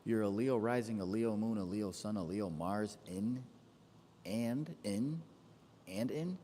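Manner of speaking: normal talking voice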